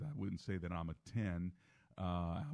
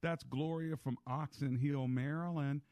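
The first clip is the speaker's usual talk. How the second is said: higher-pitched, energetic radio host delivery; radio host announcer; 10 semitones higher than usual. Acted. Host welcoming one more caller before the show ends, upbeat announcer voice. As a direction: bring the show back on air in a lifted voice.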